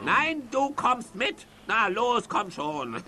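bossy command. Acted. Villain orders his servant to come along, stern and loud.

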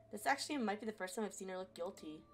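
ordinary delivery, nothing marked

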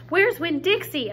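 high, loud panicked shout. Acted. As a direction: shout out, suddenly worried for the dog. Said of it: "I shouted".

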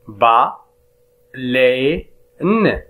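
"با" "لي" "ان" spaced out, last syllable rising; syllable split. Shown as a demonstration. Teacher splitting "بالين" for pupils, spaced syllables. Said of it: "ألوغ،".

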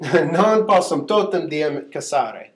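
slow read-out of a Latin line; Latin class read-aloud. Shown as a demonstration. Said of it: "just read this off like I would".

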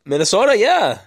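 Norwegian-tinged Minnesota accent with a hint of Shrek. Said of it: "a little bit of Norwegian mixed with a little bit of Shrek".

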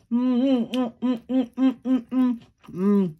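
happy humming through a mouthful; mouth full. Real, not acted. Tasting her Aldi salad bowl, humming through a mouthful. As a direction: savor the bite with muffled mms. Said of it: "talking with my mouth full".

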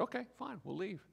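resigned, mild okay-fine voice; resigned shrug. Pretend. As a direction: give in lightly, pitched high. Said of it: "Jesus said".

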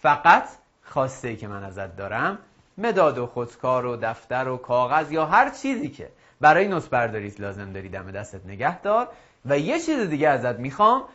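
usual speaking voice

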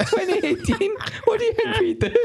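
high squealing mock reply through laughter; laughing squeal. To the mate, squealing with laughter. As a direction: squeak it out while cracking up.

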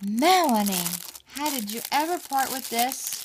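loud, high burst of delight at a friend's gift; excited exclaim. Real, not acted. Vlogger calling out to her friend over a gift, loud and thrilled. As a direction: call her name out in sheer delight.

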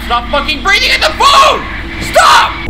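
high, loud yell into the phone; shouting, fed up; exasperated shout. Real, not acted. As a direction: yell it at a heavy breather. Yelling at the phone breather.

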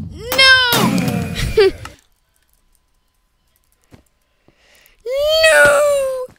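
high, drawn-out "no"; dismayed cry. Real, not acted. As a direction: wail it in dismay at losing. Losing in the game.

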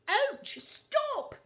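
very high, loud shriek of pain. A narrator's reading. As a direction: yelp as the claws dig in. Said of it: "shrieked Whale".

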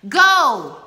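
loud shout right in his face. A narrator's reading. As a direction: blast the order at him. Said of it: "shouted Davenport in his face".

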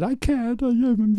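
high-pitched imitation of a tearful actress; mock sobbing. Director imitates actress refusing to act, mock sobbing. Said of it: "she was sobbing and sobbing".

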